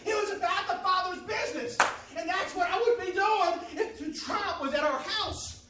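high, loud, shouted preaching climax; full-force preaching. Acted. Pastor shouting to the church, fervent and loud. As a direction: whoop it up to the congregation.

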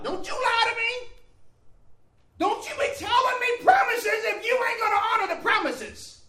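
high, loud stern warning; stern woman. Retold or imitated. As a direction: lay down the law to the prophet.

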